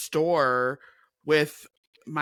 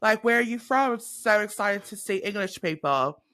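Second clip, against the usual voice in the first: higher imitation of an excited Brit; excited English accent; 7 semitones higher than usual. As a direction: mimic a thrilled English stranger. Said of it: "this guy was like".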